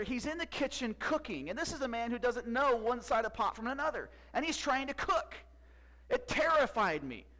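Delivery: high, loud, excited storytelling; amazed wife retelling. Retold or imitated. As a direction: pile up the astonishing news as the thrilled wife. Retells an amazed wife's report.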